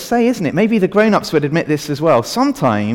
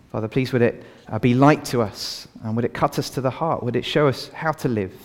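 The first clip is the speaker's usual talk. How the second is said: prayer; 4 semitones lower than usual. Real, not acted. Leads a prayer.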